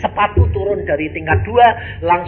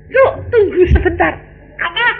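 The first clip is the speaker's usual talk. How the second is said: high, impatient back-and-forth with the sister; acted quarrel; 7 semitones higher, 6 dB louder than usual. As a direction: play the impatient suitor, pitched up.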